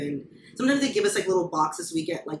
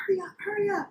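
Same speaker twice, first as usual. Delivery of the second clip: mock drill-instructor yelling; 13 semitones higher, 3 dB quieter than usual. Recruit retelling being rushed on the toilet, high mock yell. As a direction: imitate a hectoring little drill instructor, pitched high. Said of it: "she was just yelling at me".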